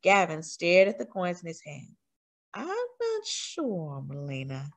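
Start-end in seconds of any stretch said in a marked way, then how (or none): 2.5-4.7 hesitant. A narrator's reading. Gavin hesitating.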